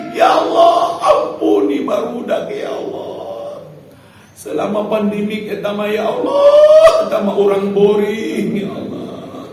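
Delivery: hammed-up loud cry to God; exasperated parent's prayer; mock pleading prayer. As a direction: beg God loudly, half-joking, about the children. Mock prayer about the kids.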